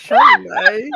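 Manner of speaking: "Sunday" as a loud, high "shun-die"; mock mispronunciation. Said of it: "we have our own vocabulary".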